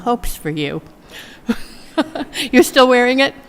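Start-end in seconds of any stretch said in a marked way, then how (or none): 2.0-2.5 laugh. Real, not acted. Laughs at nephew's reaction. 2.5-3.3 playful teasing. Real, not acted. Teasing the nephew in audience.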